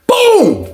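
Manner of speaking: loud, high exclaimed boom; sudden explosive sound effect; imitated boom. Storyteller punctuating his escape wish, loud imitated boom.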